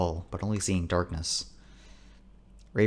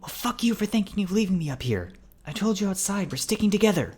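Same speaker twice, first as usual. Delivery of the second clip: high-pitched, strained telling-off; frightened indignation; indignant scolding; 13 semitones higher than usual. A narrator's reading. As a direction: scold a friend in a high, urgent voice. Indignant quarrel in shout whispers.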